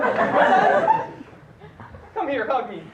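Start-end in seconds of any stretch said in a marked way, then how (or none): 0.0-1.1 high, loud squawking wah; wah cry. Acted. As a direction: blurt out a wah. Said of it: "Start your sentences with "wah".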